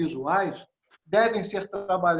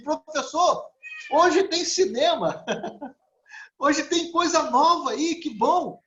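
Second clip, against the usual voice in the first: much higher, louder, eager mock student voices; excited students; 8 semitones higher, 3 dB louder than usual. Students crowding the projector, delighted, much higher and louder. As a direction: play the thrilled adult students. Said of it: "os alunos logo se aproximavam e diziam assim".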